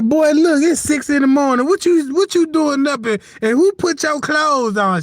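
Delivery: scolding mama. Retold or imitated. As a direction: play the startled, scolding mom. Mama finds dressed baby.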